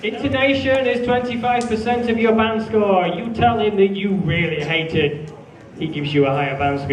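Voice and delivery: ordinary talk, nothing marked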